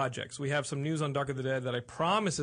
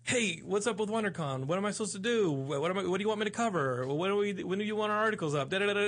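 higher-pitched mock stream of questions; mimicking a nagging colleague in a raised voice; imitating pestering writer; 7 semitones higher than usual. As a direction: pester with one question after another, pitched up. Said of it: "And Carrie was like".

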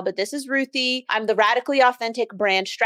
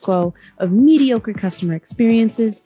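punchy, emphatic announcer read; scripted podcast opener; podcast intro read; 3 dB louder than usual. Acted. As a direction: introduce the show with measured punch on key words. Recorded podcast intro over music.

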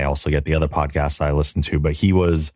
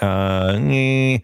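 high, wavering noncommittal groan; doubtful "uh, eh"; 7 semitones higher than usual. Retold or imitated. Fan reacting to liberties with Trek lore, doubtful groan. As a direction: voice an unsure little "ehh" of reservation.

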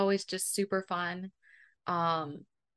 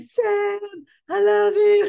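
high, loud, hammed-up lovesick wail; mock sobbing; 13 semitones higher, 11 dB louder, slower than usual. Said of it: "he's, like, sobbing".

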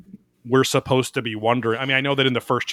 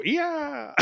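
falling yell; 5 dB quieter than usual. Retold or imitated. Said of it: "and they go".

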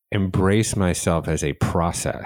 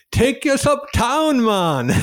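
much higher, louder, slow put-on Jamaican accent; 4 dB louder, slower than usual. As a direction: unconsciously mimic the Jamaican cabbie, pitched way up. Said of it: "we had a Jamaican cab driver".

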